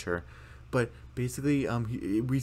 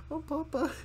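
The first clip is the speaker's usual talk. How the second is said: much higher, pleading whine; whiny kid voice. Retold or imitated. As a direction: whimper to papa. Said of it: "She's like".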